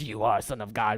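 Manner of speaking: a lightly voiced demon naming Jesus; demonic voice. Demon crying out to Jesus, in a low mock demonic voice. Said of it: "in the demonic voices".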